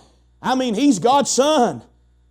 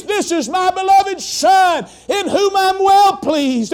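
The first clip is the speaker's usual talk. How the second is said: high, slow ringing shout; booming voice of God; 7 semitones higher, slower than usual. Acted. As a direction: proclaim it from heaven, drawn out. God's voice shouted from heaven.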